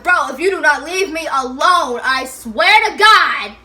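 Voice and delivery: loud, slowed threat building to a yell on God; snapped warning. Retold or imitated. Telling the boyfriend to leave her alone, shouting. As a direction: lose patience and shout the warning. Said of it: "so then I snapped".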